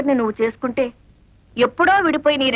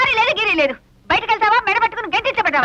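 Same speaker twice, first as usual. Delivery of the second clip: angry scolding; 6 semitones higher than usual. Acted. Woman throws out intruder.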